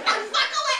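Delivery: high-pitched botched Scottish retort; bad Scottish accent. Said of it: "that was not a Scottish accent".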